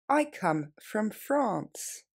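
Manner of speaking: very slow, word by word; slowly. Shown as a demonstration. Teacher slowing down for students to repeat. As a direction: draw each word out clearly. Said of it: "Plus lentement".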